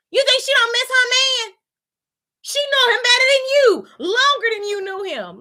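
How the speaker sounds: high, loud indignant shout; outraged shout. Real, not acted. Defending the widowed mother to viewers, high outraged yell. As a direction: yell it in disbelief at the character.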